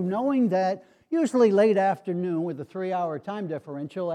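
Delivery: normal talking voice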